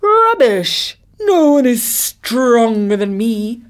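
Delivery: high loud burst, then slow boasting; trumpeting elephant. A narrator's reading. Elephant scorns the tortoise's boast, trumpeting. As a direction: trumpet it out as a huge scornful beast. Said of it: "trumpeted the bigger of the two beasts".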